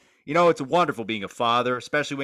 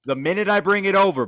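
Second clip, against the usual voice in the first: 1 shouted comeback; 6 semitones higher, 4 dB louder than usual. Real, not acted. [name]'s comeback about the party.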